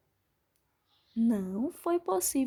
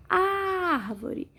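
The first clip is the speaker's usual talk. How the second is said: "Árvore" with ÁR stretched long and high; stress on ÁR; 6 semitones higher than usual. Shown as a demonstration. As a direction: draw out the ÁR in "Árvore". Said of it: "Sílaba mais forte é o ar".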